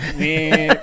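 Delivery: rising robotic zap; robot noise; robot buzz. Retold or imitated. Robots grabbing and shaking.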